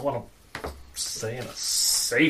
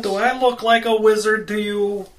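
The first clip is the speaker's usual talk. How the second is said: indignant; 7 semitones higher, 7 dB louder than usual. Acted. Indignant retort to party member.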